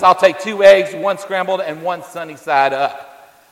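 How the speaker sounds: gruff husband barking out an order, slowing at the end; loud gruff demand that then slows down; grumpy husband growls his breakfast order at his wife; rough voice. Retold or imitated. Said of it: "In a rough voice".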